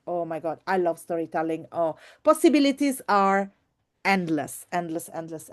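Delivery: ordinary talk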